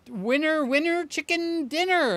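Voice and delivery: high, loud gleeful call; triumphant sing-song shout; excited catchphrase. Pretend. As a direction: cheer it out like a game show host.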